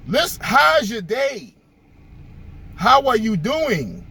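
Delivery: slow, deliberate how's your day; a mock patient, slowed-down repeat of the greeting; slow exasperated repeat. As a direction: spell it out slowly for someone who missed the point. Brother repeats his simple greeting.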